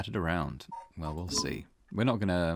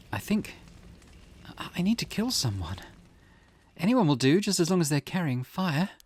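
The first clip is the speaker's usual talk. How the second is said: higher-pitched, halting, then louder and urgent; cursed bloodlust; 9 semitones higher, 8 dB louder than usual. Acted. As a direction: build from hesitant confession to urgent craving. Character overcome by urge to kill.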